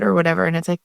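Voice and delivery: usual speaking voice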